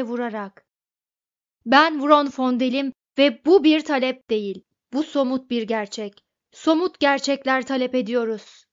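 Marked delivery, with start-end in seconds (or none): 1.7-8.6 loud, emphatic insistence; shouted, indignant demand; shouted declaration. A narrator's reading. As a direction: declare it forcefully at raised volume. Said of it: "diye bağırdı Vronfondel".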